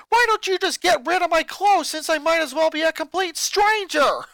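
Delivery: put-on high, loud furious boy shouting at family; a boy exploding at his mother; angry boy ranting. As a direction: snap back at Mom, furious. Lincoln furious at parents.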